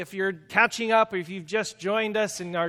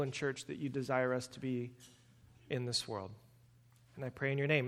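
prayer; 4 semitones lower, 6 dB quieter than usual. Acted. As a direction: pray it gently and sincerely. Pastor prays before sermon.

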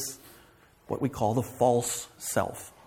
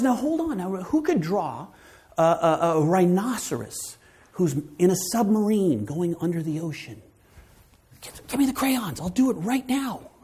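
put-on eager child; 6 semitones higher than usual. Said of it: "And he says".

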